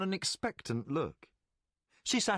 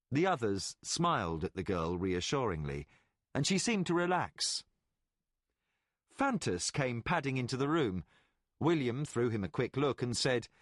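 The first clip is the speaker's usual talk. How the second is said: plain reading voice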